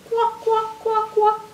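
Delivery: high-pitched duckling quack; tiny duck, very high; little baby duck. Acted. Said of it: "kinda like a little baby duck".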